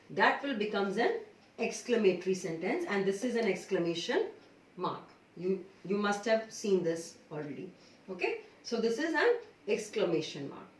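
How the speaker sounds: ordinary talk, nothing marked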